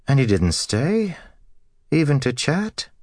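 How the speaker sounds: high-pitched, puzzled questions; surprised wife. Acted. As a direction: ask in surprise, lifting the pitch well up. Wife asks about departed visitor.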